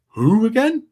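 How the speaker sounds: high, blank "who again?" as if never heard of me; mock puzzled. Calvin hearing the praise and drawing a blank, mock puzzled. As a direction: play the guest forgetting who I am. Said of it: "he's gonna be".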